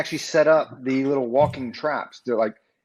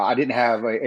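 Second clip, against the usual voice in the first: ordinary talk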